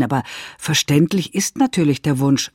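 ordinary talk

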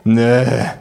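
contemptuous no. Acted. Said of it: "sag ich verächtlich".

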